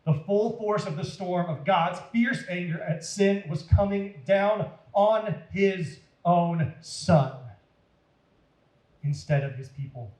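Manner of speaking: ordinary delivery, nothing marked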